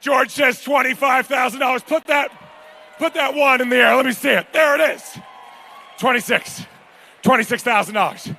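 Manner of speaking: high, shouted auctioneer calls; hyped bid calling. Acted. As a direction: get the paddle up in the air.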